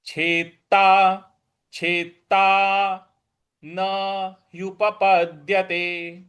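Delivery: छेता न युपपद्यते drawn out slowly; slow syllable-by-syllable. Shown as a demonstration. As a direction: dictate it slowly for repeating. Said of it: "अगला पद है". (धीरे धीरे अक्षरशः पाठ)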